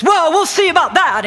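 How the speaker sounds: loud, high-pitched huffy retort; indignant challenge. Retold or imitated. Clerk doubting the customer's story, indignant and loud.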